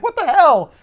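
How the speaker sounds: high, loud indignant shriek; shocked outburst. Retold or imitated. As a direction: squeal in outrage. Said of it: "She's like".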